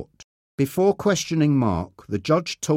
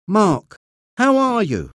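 higher and louder, eager cry; cried out; 9 semitones higher, 4 dB louder than usual. A narrator's reading. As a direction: call out to her son with relief. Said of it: "she cried".

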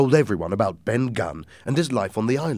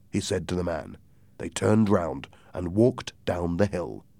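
ordinary delivery

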